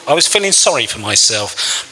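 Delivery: ordinary delivery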